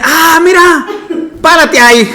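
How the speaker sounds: high, loud laughing delight; excited old man through laughter. Retold or imitated. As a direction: call out happily, laughing. Bishop spots the menudo stand.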